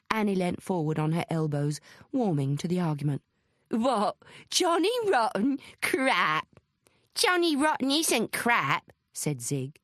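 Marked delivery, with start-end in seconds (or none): 3.7-6.5 high, disbelieving outburst; incredulous scorn. A narrator's reading. Annie warming to a pub argument. 7.1-8.9 high, slow, insistent contradiction; drawn-out indignant protest; indignant rebuttal. A narrator's reading. Zig contradicts Annie in argument.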